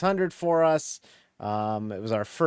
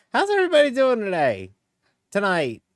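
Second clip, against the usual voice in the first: excited greeting; 8 semitones higher, 6 dB louder than usual. Real, not acted. Streamer greeting, loud and high.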